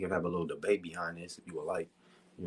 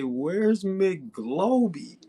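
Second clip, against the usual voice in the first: a drawn-out, much higher mock of "Where's McGloby?"; mocking imitation; 10 semitones higher, 5 dB louder, much slower than usual. Teasing a player by parroting his question in a mock voice. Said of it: "Bruh say".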